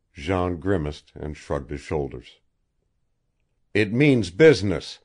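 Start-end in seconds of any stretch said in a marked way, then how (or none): 3.7-5.0 louder, firm warning; a steady threat, the gun beside him; warningly. A narrator's reading. Captor warns his prisoner at the table, firm and loud. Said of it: "said his captor warningly".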